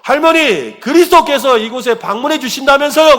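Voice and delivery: high, loud acted shout; shouted question. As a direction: shout it for someone hard of hearing. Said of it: "더 큰 소리로".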